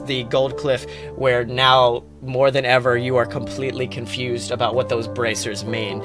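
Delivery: ordinary talk, nothing marked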